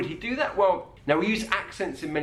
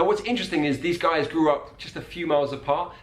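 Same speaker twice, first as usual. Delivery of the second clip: ordinary talk, nothing marked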